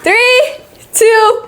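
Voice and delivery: high loud countdown; excited countdown. Real, not acted. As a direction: build up the reveal. Countdown to the reveal.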